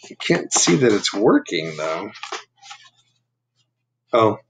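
plain reading voice

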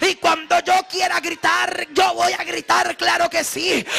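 fiery Spanish preaching; preaching at full force. Acted. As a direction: pour it out like a revival sermon.

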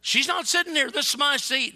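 imitating an angry man, loud and high; angry objection. Man refusing a woman the airplane seat, angry shout. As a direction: bark it like a man claiming his seat.

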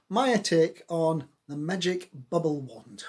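ordinary delivery

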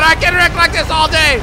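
loud, high boast; exultant shout. Real, not acted. Gamer wrecking everything in sight, exultant shout. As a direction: shout it out, thrilled with yourself.

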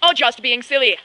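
loud upbeat pitch; hard sell ad. Acted. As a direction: sell it loudly. Ad announcer line.

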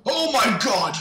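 loud startled shout, starting high and dropping; real scare, high outburst that falls away. Real, not acted. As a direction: jump with fright and yell. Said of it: "you scared the fuck out of me".

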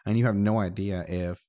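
ordinary talk

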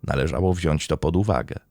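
steady, measured storytelling read; crime podcast narration. Acted. Host recounting a murder trial to listeners, in a steady narrative read. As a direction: lay out the court case like a documentary narrator.

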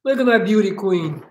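ordinary delivery

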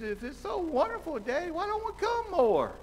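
put-on high, light little-boy voice; child voice. Little Johnny asking his daddy, high child voice. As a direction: lift into a small child's plea. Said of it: "He said".

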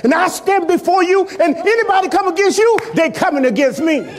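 shouted pulpit climax; full-force preaching. Acted. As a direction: thunder the names of God.

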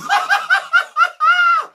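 a squealing burst of laughter; high shrieking laugh. Real, not acted. Laughing at own joke.

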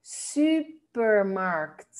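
supermarkt, very slow, long rounded Dutch U in "su"; model pronunciation. Shown as a demonstration. Teacher modelling "supermarkt", slow, long double-U sound. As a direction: stretch "supermarkt" with a "süü" opening. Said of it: "suena como doble U".

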